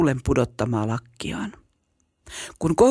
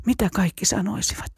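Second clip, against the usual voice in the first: ordinary delivery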